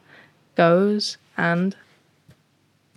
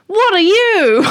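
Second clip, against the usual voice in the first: very high, loud cry of a startled cat; 12 semitones higher, 10 dB louder than usual. Acted. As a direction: blurt it out high and loud as a scared kitty. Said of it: "And Speckle says".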